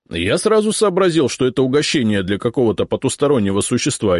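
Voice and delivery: high, loud chatty reporter; eager reporter. A narrator's reading. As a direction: rattle it off, eager and pleased. Reporter figure held.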